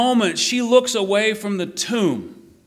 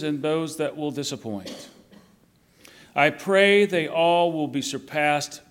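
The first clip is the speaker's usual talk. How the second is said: prayer; 3 semitones lower than usual. Acted. Reads out a written Easter prayer.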